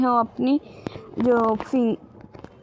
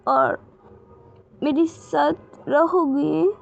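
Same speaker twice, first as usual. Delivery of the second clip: put-on high, small child's pleading voice; a lost little girl asking to be cared for; little girl's voice; 6 semitones higher than usual. A narrator's reading. Little Wing asks Gaga to stay, in a high childlike plea.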